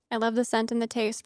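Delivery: ordinary talk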